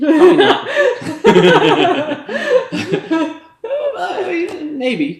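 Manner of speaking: high laughing words. Real, not acted. Laughing at own joke.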